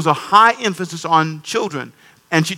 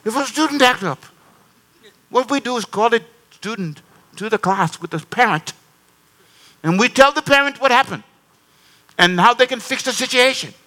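a hint of a serious woman, pitched higher; serious visitor; 6 semitones higher than usual. Guest explaining school discipline, lightly voiced and serious. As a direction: lay out the rule gravely. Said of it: "she got real serious".

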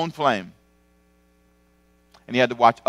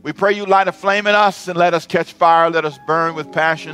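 higher, louder prayer voice over music; fervent prayer; 4 semitones higher, 4 dB louder than usual. Acted. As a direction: lift the room in closing prayer.

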